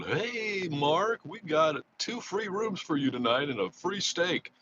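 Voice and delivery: high, slow, eager host offering free comps; casino host's call. Retold or imitated. Casino contact calling to offer rooms, high and slow sales pitch. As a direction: pitch it up like the friendly casino guy with a deal. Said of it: "a guy that's always calling me going".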